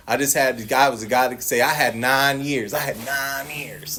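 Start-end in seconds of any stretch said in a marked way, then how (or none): 2.7-4.0 softer, slow echo of the boast; quiet repeat. Retold or imitated. A man repeating his clean time to himself, soft and slow. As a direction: let it fall away hollow.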